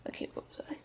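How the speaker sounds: soft unvoiced whisper, finding the place; whispered aside. Real, not acted. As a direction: murmur it under your breath. Whispered return to text.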